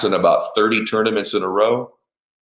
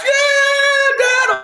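high, loud, drawn-out celebration scream; imitating a son's wild cheer; ecstatic kid; 5 dB louder than usual. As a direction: squeal with joy like a winning boy. Son screams after double eagle.

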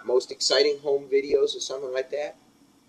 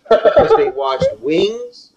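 mocking disbelief; 9 dB louder, much slower than usual. Real, not acted. Mocking incredulous rhetorical question.

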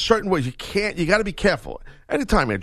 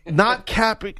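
ordinary delivery

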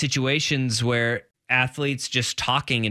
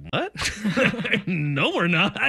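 high-pitched mock incredulity, laughing through it; amused disbelief; 9 semitones higher, much slower than usual. Retelling a receiver rejecting his rival's comparison, high and incredulous. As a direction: scoff at the claim in a high, laughing voice.

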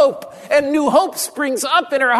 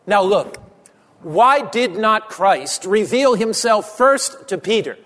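normal talking voice

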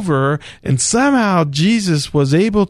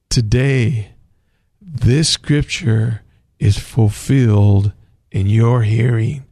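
lower, lightly voiced Jesus; quoting Jesus; 6 semitones lower than usual. Jesus to the synagogue crowd, lightly voiced lower. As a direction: drop the voice a little for Jesus's words. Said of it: "and he says this".

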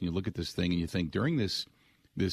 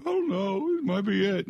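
mock tearful wail; 10 semitones higher than usual. Favre leaving field crying.

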